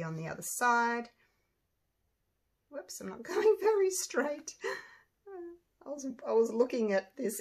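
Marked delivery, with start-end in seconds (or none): none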